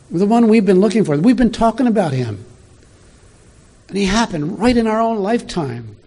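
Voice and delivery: bursting with the news for a friend; excited. Acted. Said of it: "I can hear the excitement".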